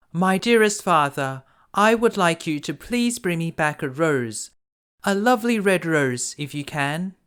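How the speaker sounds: put-on high, sweet smiling girl voice; smiling Beauty. A narrator's reading. Beauty asking her father for a rose, sweet and smiling. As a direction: ask gently with a smile, voice lifted. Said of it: "replied Beauty with a smile".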